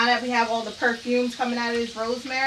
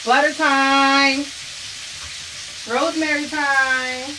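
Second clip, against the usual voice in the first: loud drawn-out announcement; announcing; 4 semitones higher, 7 dB louder, slower than usual. Real, not acted. Cook announces the butter, loud and drawn out. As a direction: call it out slow and loud like a show host. Announcing next cooking step.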